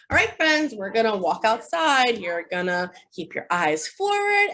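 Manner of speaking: put-on line up the kids brightly; teacher addressing class. Shown as a demonstration. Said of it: "So we'll say".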